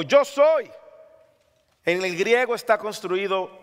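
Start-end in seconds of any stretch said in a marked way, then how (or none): 0.0-0.7 raised, high proclaiming call; slow, loud Jesus reassuring disciples. Acted. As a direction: call out over the storm. Quoting Jesus.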